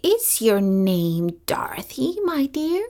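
put-on whispered, gentle old witch; whispered question. A narrator's reading. Witch of the North gently asking the child her name, whispered. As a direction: lean in and whisper kindly.

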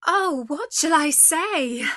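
high, loud, slow cry of dismay; frightened cry. A narrator's reading. Beauty afraid to anger the beast by refusing, crying out. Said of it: "cried Beauty, for she was afraid".

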